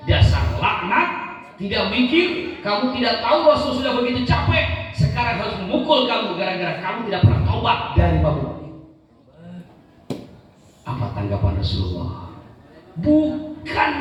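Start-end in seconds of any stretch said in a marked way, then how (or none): 0.1-8.5 mildly heated scolding, raised pitch; angry scolding. Acted. Companion scolds Nuaiman for tiring the Prophet, angry. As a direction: scold him with rising indignation. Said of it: "dia marah terhadap Nuaiman".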